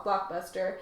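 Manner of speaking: usual speaking voice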